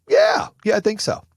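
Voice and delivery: a proud, jumped-up yes; high excited yeah. Real, not acted. Boasting answer to own question.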